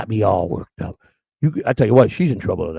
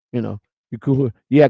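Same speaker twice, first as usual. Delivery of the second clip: normal talking voice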